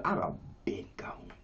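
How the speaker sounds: whispered, breath only; hushed whisper. Real, not acted. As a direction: drop to a grateful whisper. Testimony of near death, hushed.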